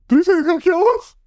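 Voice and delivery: put-on a frightened kid blurting it out; panicked kid. The kid in the bank panics at the threat, high and rushed.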